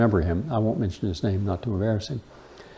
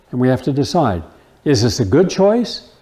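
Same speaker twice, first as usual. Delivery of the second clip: ordinary talk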